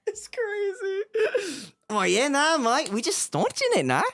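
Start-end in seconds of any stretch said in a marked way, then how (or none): 1.9-4.0 put-on cheeky Australian accent, a bit higher. Streamer plays his Australian character for his friend in a cheeky Australian accent. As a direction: throw it off like a larrikin Aussie. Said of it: "I've never done this accent before".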